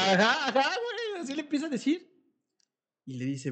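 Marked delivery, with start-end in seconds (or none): 0.0-2.1 high, laughing squeal of agreement. Real, not acted. Agreeing with a friend about the fight, laughing high. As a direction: laugh it out, pitch climbing.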